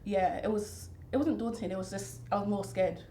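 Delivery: usual speaking voice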